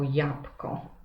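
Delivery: "jabłko" with no ł, as yapko; simplified pronunciation. Shown as a demonstration. Teacher explaining the dropped consonant to pupils, simplified "jabłko" as japko. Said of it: "wyrzucamy w wymowie potocznej spółgłoskę ły".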